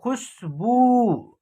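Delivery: खुशबू slow, short खु then a long held बू; drawn-out ending. Shown as a demonstration. Teacher showing खुशबू's pronunciation, final ऊ pulled long. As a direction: stretch the बू of खुशबू right out. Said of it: "इसका उच्चारण खींच करके होगा".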